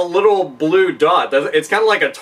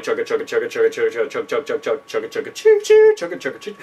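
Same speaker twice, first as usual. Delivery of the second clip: lower, rhythmic chugga chant; chugga chugga train noise; 6 semitones lower, faster than usual. Retold or imitated. Mimicking himself chugging beer, lower train-like chugga chant.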